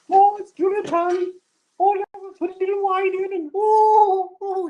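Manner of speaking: very high, fluty mock Julia Child warble; Julia Child impression. Reading a viewer comment, mock Julia Child falsetto. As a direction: ham up a trilling old TV chef.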